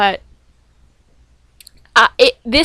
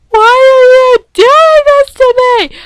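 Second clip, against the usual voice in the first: much higher and louder, slow crying shout; anguished wail; 13 semitones higher, 9 dB louder, slower than usual. Retold or imitated. Fan to the referee, slow tearful anguished shout. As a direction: wail it out like the belt was stolen. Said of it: "I'm not gonna tell you how angry I was".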